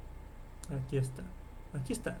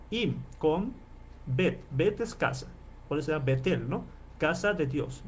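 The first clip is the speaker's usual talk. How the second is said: ordinary talk, nothing marked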